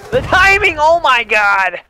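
high excited yell of disbelief; excited shout. Real, not acted. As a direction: shout it out in amazement. Excited reaction to play.

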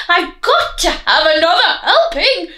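high, loud yelping wolf. Acted. Wolf running round the kitchen, loud yelping. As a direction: yelp it out as you run about.

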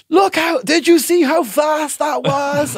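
high, loud, drawn-out exclamation; retold crowing shout, stretched out; excited boast. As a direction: shout it in amazed self-congratulation. Retells boasting about quick comeback.

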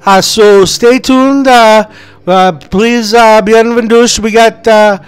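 high, eager Italian waiter. Acted. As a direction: play a jolly pizza waiter. S0 plays the waiter Salvadori.

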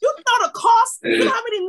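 high, loud challenging questions; impassioned preaching. Real, not acted. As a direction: demand it of the congregation at full voice. Impassioned testimony of long struggle.

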